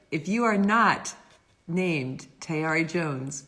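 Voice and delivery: normal talking voice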